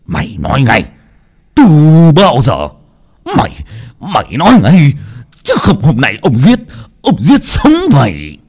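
angry father yelling at his son, rising in pitch; furious shout. A narrator's reading. Father shouting at his son to confess, furious yell. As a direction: bark it out, threatening to kill. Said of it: "túm cổ con mà hét lên".

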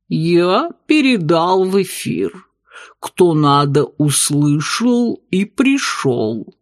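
slow low alien voice; gurgling tentacle alien. Acted. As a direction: stretch it out, otherworldly. Octopus bartender speaks.

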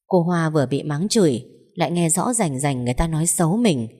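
plain reading voice

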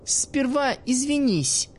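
put-on the girl demanding an apology from the old man. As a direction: insist on an apology, each word deliberate. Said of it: "потребовала она".

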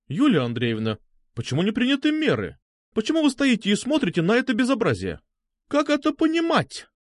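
high-pitched, singing teacher's outrage; operatic indignation. A narrator's reading. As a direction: scold grandly like an opera diva. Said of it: "оперного контральта". (оперное негодование учительницы)

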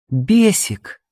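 high-pitched female character call; woman's summons. A narrator's reading. Woman calling the dazed admirer, commanding. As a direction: call him to attention in a high voice. Woman calls him with cold look.

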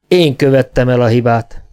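ordinary delivery

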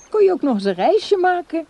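high, loud burst of enthusiasm; urging him to move in, bursting with excitement; excited suggestion. Acted. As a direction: sell the idea with sudden delight. Tempting him with a trip.

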